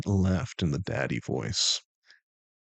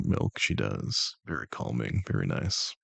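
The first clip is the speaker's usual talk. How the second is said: low voice; 10 semitones lower, 4 dB quieter than usual. Pretend. Dropped voice praising.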